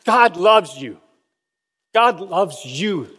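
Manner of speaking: slow, earnest repeated plea; Billy Graham pleading. Retold or imitated. Preacher tells the vast audience, slow and earnest. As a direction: press the words home, slowly. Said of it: "he would say".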